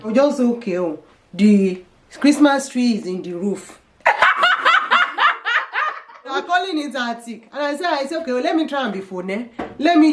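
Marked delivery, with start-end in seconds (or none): 0.4-3.8 slow, heavy Nigerian accent. Shown as a demonstration. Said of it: "If I want to use my Nigerian accent". 4.0-6.0 shrieking laugh; a sudden high cackle; high burst of laughter. Real, not acted. Laughs at her own joke.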